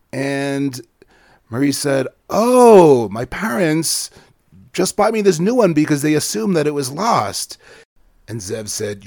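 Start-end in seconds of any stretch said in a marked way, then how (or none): 2.3-7.6 cheerful boast. Acted. Murray explains new bike.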